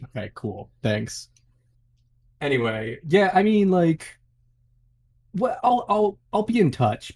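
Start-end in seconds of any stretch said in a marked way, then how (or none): 3.1-7.1 much higher flustered exit; pitched-up, awkward goodbye. Real, not acted. Blog runner awkwardly leaving, voice pitched up.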